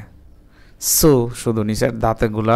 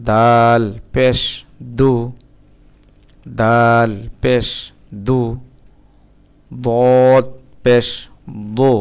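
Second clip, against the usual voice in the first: slow, deliberate Arabic spelling drill, each letter and vowel named; much slower than usual. Shown as a demonstration. Teacher drilling students through the letters with pesh, slow and deliberate. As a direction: chant the letters out slowly, one by one, for a beginner.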